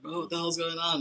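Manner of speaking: high mock alarm; imitating alarm. Retold bystanders' alarm at a seizure.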